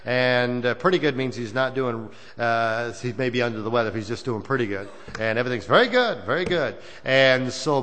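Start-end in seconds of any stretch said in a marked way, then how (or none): none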